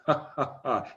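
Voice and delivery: soft, low "ha-ha" chuckle; demonstrated laugh. Shown as a demonstration. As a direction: model the laugh to hear inside. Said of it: "Hear that".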